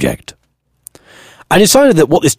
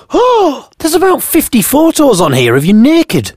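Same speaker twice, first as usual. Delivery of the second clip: high, shocked exclamation. Acted. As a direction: gasp it out in shock. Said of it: "understandably sounding shocked".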